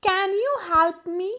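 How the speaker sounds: drawn-out teaching question with a lift; teacher stressing the can question. Shown as a demonstration. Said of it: "वहाँ पे आप can के साथ sentence शुरू करोगे।". (धीमा और ऊँचा सवाल)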